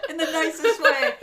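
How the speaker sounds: high, loud words through laughter; laughing hard. Real, not acted. Host softening her curse to the co-host, laughing. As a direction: squeeze it out while cracking up.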